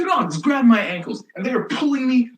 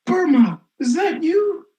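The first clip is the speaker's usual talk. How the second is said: high teacher surprise; 11 semitones higher than usual. Retold or imitated. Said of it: "she's like".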